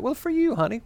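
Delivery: high, sugary coaxing voice; husband buttering up his wife, high voice; sweet-talking husband. Acted. Husband sweet-talks suspicious wife.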